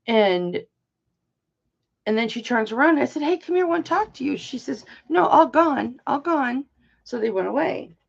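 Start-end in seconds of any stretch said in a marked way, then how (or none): 3.2-4.4 higher-pitched coaxing call; calling child over. Retold or imitated. Grandmother calls the child over. 5.1-6.7 put-on high, louder toddler-like reply; imitating small child. Voicing her three-year-old granddaughter.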